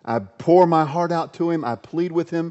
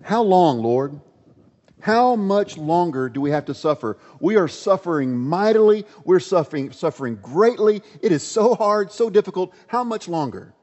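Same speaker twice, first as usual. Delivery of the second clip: raised, higher voice, begging God; louder, higher lament of a suffering prophet; pleading prophet; 5 semitones higher, 4 dB louder than usual. Acted. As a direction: cry out to God in anguish. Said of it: "The prophet Habakkuk says".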